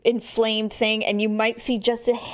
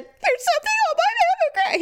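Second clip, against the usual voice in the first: high, wailing hammed-up alarm; mock panic. Woman telling husband the bad news in hammed-up high panic. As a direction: play her own past panic, overdone. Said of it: "And I said".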